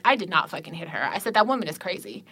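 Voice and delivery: low, hushed retold denial; quiet and calm. Shopper denying it to the store manager, quiet and calm. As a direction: keep it soft and controlled. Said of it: "I'm being really quiet and calm".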